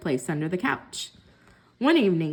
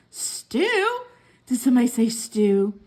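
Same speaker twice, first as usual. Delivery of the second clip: excited cat. A narrator's reading. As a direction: jolt awake hungry for stew. Cat wakes hearing stew.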